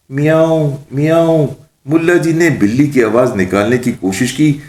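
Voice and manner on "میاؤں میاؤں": high-pitched, odd-sounding cat imitation; botched cat meow. Mullah tries a meow for the witch, strangely botched. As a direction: meow as if something is stuck in the throat. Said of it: "جس کی وجہ سے عجیب سی آواز نکلی".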